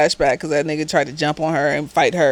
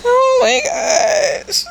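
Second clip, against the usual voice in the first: exasperated cry; much slower than usual. Real, not acted. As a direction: drag it out in real exasperation. Reacting to the realtor excuse.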